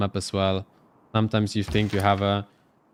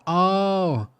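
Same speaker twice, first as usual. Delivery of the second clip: sharp, high startled oh; sudden surprise; 9 semitones higher than usual. Real, not acted. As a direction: caught off guard by a popup. Picture suddenly blocks the screen.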